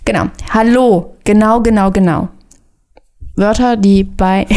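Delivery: ordinary talk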